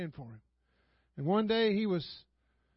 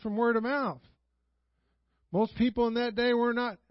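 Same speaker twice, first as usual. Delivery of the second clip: louder, driving sermon voice; fiery preaching; 4 semitones higher, 5 dB louder than usual. Acted. Pastor rouses the congregation about the first church, preaching hard.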